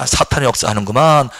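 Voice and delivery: low knowing tone; calm low recognition; say it for us to repeat. Shown as a demonstration. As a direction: model the line for the congregation. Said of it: "따라합시다".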